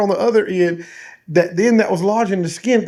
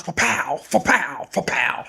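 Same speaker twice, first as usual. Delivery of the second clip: soft, popped 'fa-pow' sound effects; imitated impact noises; 4 semitones lower, 6 dB quieter, slower than usual. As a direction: mimic three quick hook strikes. Imitates hook striking three times.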